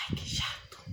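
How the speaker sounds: breathy annoyed aside, under the breath; annoyed whisper. Retold or imitated. Complaining to herself about her father-in-law, whispered annoyance. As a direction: mutter it like a fed-up sigh. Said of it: "às vezes eu ficava brava também".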